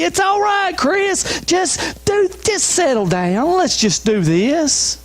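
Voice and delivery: coaxing voice of the culture. Retold or imitated. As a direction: cajole like a friend talking you out of it. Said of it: "The culture's screaming to us".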